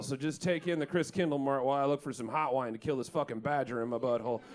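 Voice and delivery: ordinary talk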